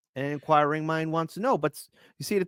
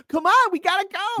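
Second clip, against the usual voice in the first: high panicky mock voice. Panicked crowd in the movie.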